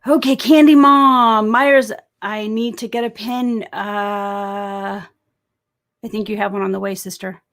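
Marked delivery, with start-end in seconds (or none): 0.1-1.9 loud, raised shout-out; big high-pitched call. Real, not acted. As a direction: holler to a viewer by name. Calling out to a viewer.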